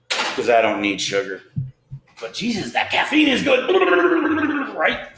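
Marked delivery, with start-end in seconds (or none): 2.2-5.1 excited. Real, not acted. Joking about caffeine kick.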